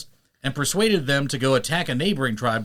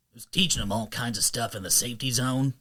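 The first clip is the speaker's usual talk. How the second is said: Mordecai voice; 2 semitones lower, 4 dB quieter than usual. Retold or imitated. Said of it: "Kinda talks like this".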